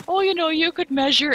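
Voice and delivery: teasing. Retold or imitated. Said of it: "she's like".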